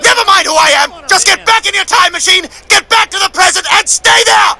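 high furious yell; shrieking order to leave now; angry yell. Acted. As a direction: scream at him to get back. Future self yelling orders.

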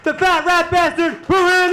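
big ring-announcer style send-off; announcer shout. Real, not acted. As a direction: draw out the introduction for applause. Announcer bringing applause for comic.

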